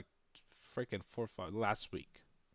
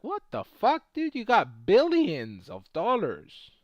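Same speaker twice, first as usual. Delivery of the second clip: retold disbelief; 7 semitones higher, 7 dB louder, slower than usual. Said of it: "I was like".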